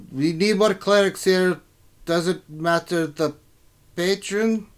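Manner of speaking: dwarf king. Acted. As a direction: grudgingly concede as a dwarf king. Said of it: "she whispers to something and said".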